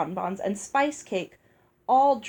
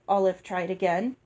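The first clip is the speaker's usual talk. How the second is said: ordinary delivery, nothing marked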